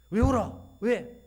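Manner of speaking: sharp scolding rebuke, rising on the questions; a mild mock scolding of a weeping woman. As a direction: scold her for crying. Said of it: "이렇게 혼을 내는데".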